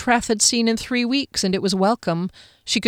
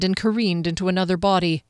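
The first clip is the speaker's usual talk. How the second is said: usual speaking voice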